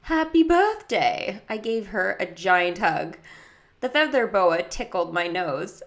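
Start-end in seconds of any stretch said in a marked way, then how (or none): 0.0-1.4 high, slow mock-English cheer; fake English accent. A narrator's reading. Girl greets birthday friend, fake English accent. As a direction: wish it grandly in a put-on English accent.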